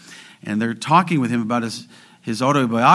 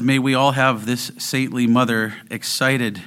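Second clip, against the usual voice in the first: usual speaking voice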